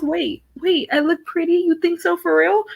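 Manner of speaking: mock amazement. Sister gets a rare compliment, mock amazement. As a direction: imitate an astonished little sister.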